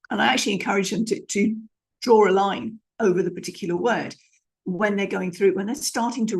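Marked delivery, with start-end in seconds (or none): none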